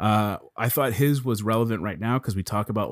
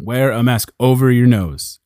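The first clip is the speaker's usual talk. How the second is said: emphatic; 2 semitones higher, 9 dB louder than usual. Real, not acted. Urging listeners to wear masks.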